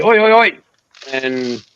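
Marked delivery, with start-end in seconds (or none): 0.0-0.6 higher, louder Aussie "oi oi oi" chant; a lightly done Aussie cheer. Retold or imitated. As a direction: call it out like an Aussie fan. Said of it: "If he was an Aussie".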